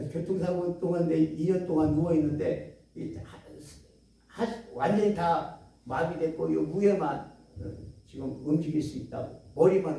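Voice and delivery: put-on low, soft, faint voice of a paralysed girl; weak young patient. Bedridden girl quietly tells the preacher about her paralysis, low and faint.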